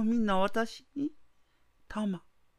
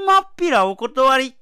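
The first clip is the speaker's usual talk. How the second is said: loud, spiteful refusal; angry refusal; 3 semitones higher, 9 dB louder than usual. Acted. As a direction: spit the refusal out. Wife spurns the dying tengu.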